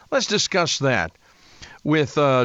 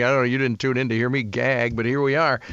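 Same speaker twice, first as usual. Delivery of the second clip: ordinary talk, nothing marked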